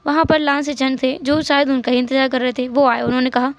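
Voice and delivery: ordinary delivery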